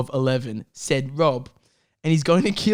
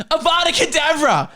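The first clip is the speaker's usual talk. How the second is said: high, louder incantation; mock curse; 11 semitones higher, 3 dB louder than usual. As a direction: cast the killing curse with drama. Reading Gandhi's killing curse.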